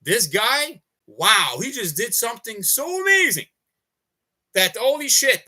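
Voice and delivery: imagined onlooker's mild, awed exclamation; amazed onlooker. Pretend. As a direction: gush like someone who just saw something incredible. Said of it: "inspire them to feel like".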